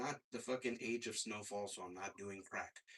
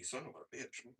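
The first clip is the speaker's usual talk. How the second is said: usual speaking voice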